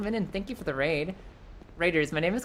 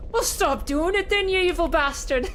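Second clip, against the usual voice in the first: put-on broad Scottish accent; 10 semitones higher, 3 dB louder than usual. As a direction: shout it like a Glasgow fan, laughing. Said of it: "a broad Scottish accent pierced through".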